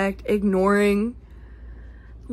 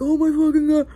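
mock exasperation; 6 semitones higher, 5 dB louder than usual.

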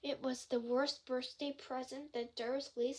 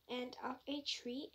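plain reading voice

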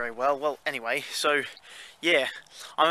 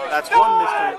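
excited; 7 dB louder than usual. Real, not acted. Excited cheer on the bus.

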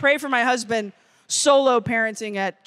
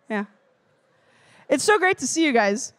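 normal talking voice

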